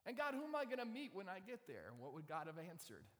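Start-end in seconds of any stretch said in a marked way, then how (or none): 0.1-2.0 high, loud, pressing question; anxious questioner. Acted. Abram questioning God about the land, high and insistent.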